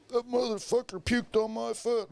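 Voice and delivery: high, mock-angry stranger; angry guy quoted. Stranger at the party complaining, mock-angry high voice. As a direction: do the furious guy with vomit on his foot. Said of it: "I hear this guy going".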